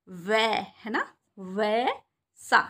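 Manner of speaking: वै and वैसा stretched very slowly, ऐ like the a in tab; drawn-out vaisa. Shown as a demonstration. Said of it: "ए की sound आती है ना". (वैसा में ऐ लंबा खींचो)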